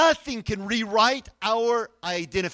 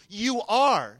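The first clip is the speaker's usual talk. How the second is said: ARE hit louder and firm; emphatic; 3 semitones higher, 5 dB louder than usual. Shown as a demonstration. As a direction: drive home the word "are". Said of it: "and he's emphatic".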